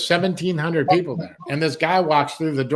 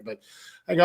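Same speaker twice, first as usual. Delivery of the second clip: ordinary talk, nothing marked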